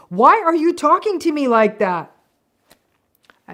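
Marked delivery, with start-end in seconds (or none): 0.1-2.1 indignant, much higher and louder retort; mock angry gaslighting. Narcissist turning on the partner who repeated herself, indignant. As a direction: snap back as if you're the one out of line. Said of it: "they'll get mad and gaslight you".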